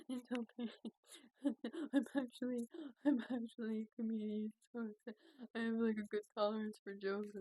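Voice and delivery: mock crying. Patient reassures the joking EMT, imitated tearful voice. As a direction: act out putting on a brave face through tears. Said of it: "I was crying".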